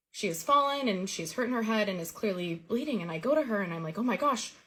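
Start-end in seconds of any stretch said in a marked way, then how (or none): 4.0-4.5 high, worried rush of questions; panicked concern for a hurt child; alarmed. Retold or imitated. Finding injured bleeding cousin.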